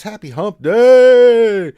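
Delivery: slow, loud, high greeting; drawn-out cheer. Acted. Streamer reading a viewer's greeting, big drawn-out cheer. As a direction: belt the greeting out like an announcer.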